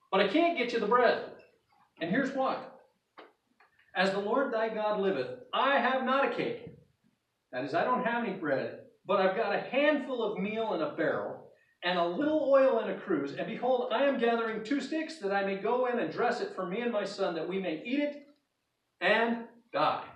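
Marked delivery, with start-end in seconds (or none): 0.1-6.7 loud, higher, pleading refusal; widow's plea. Acted. Widow answering the prophet. 9.0-20.1 higher, louder lament; widow's lament. Acted. Widow explaining her last meal.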